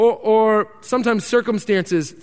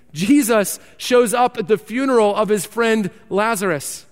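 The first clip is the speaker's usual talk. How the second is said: normal talking voice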